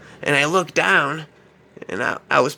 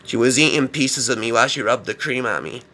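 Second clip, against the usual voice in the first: ordinary talk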